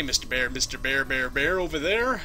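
usual speaking voice

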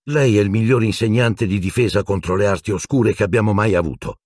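ordinary delivery, nothing marked

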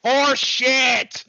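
high, loud scornful shout; imitating John's repeated outburst; mock shout. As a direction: yell it out again with scorn. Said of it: "he says it again".

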